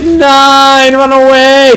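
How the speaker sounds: high, loud frantic cry; panicked yell. Retold or imitated. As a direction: shout it in a panic like a fleeing hero. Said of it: "so Tiny's like".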